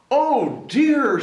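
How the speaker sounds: high, loud fretful cry; a startled "Oh dear"; alarmed mother. A narrator's reading. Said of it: "said her mother".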